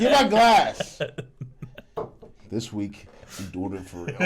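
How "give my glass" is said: slow, louder mock demand; calm turning angry. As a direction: drag out a hammed-up demand. Repeats demand, slower, louder.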